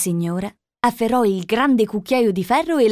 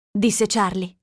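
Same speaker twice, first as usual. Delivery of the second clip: ordinary delivery, nothing marked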